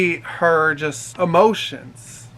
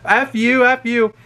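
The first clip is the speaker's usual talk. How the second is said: higher, punchy mock insults; mock drunken outburst; 6 semitones higher, slower than usual. Drunk passenger lashes out at the helper, mock tantrum. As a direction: imitate a girl's high, insulting tantrum.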